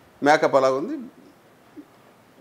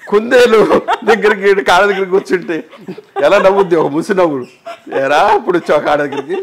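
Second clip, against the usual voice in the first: laughing retelling; 7 semitones higher, 10 dB louder than usual. Real, not acted. Laughing, retelling director's smirk.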